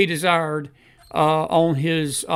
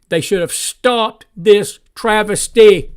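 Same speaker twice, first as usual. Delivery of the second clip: stretched, emphatic and louder; slow, louder indignation; 3 semitones higher, 7 dB louder, slower than usual. Real, not acted. Host to viewers, slow loud indignation. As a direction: lean on travesty slowly.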